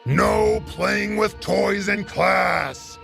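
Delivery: slow, drawn-out teacher shout; horrible yelling. Acted. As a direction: bellow a rule at the whole class, dragging each word. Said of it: "a horrible noise of somebody yelling".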